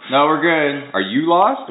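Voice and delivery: acted retort. Retelling his reply to the neighborhood watch, mock loud retort. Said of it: "remember, I go".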